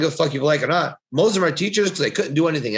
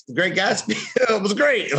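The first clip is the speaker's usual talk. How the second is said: laughing through the words, pitched up; cracking up as you say it; real laughter breaking into the line; 10 semitones higher than usual. Real, not acted. Joking about the book.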